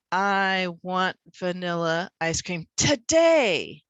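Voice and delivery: slow build to a loud, long "today"; stress on TODAY. Shown as a demonstration. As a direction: punch the second syllable of "today". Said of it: "the stress louder and longer".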